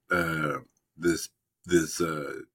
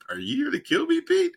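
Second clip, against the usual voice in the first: much higher, squeaky frightened voice; hammed-up fear, pitched way up; mock scared squeak; 3 dB louder than usual. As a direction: play a panicked man squeaking out a question. Scared therapist caught by Pete.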